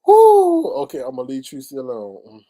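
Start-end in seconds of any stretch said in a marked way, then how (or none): 0.1-0.7 loud, high-pitched "woo"; amused whoop. Real, not acted. Host reacting to gossip about the coffee pot, amused whoop. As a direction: let out a big whooping "woo".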